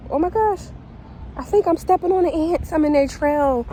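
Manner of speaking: startled, high and loud; sudden alarmed realization; surprised. Real, not acted. Noticing ants underfoot.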